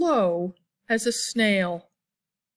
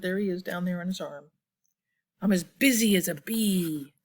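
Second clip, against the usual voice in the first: plain reading voice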